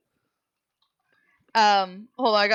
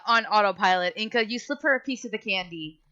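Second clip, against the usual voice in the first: ordinary delivery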